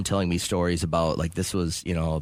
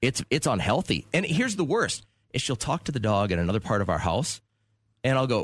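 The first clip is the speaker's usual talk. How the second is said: ordinary talk, nothing marked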